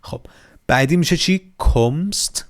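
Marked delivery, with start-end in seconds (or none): 1.6-2.5 کمست as one syllable, "komst"; correct German pronunciation. Shown as a demonstration. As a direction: say کمست with no vowel between m and st. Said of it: "پس کمست نه کمست".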